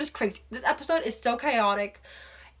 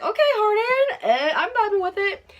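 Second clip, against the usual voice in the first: swooning fan; 10 semitones higher, 5 dB louder than usual. Retold or imitated. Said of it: "I was like".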